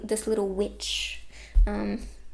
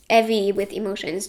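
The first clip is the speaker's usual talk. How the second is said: usual speaking voice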